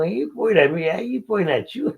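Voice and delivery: high, incredulous back-and-forth; mock astonished, voice climbing up; excited disbelief. Excited retold exchange, high pitch.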